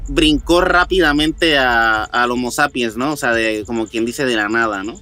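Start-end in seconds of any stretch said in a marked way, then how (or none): none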